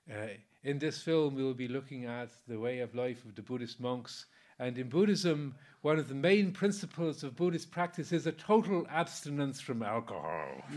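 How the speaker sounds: lightly imitating an Irish priest; bad Irish accent. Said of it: "you'll excuse my bad Irish accent".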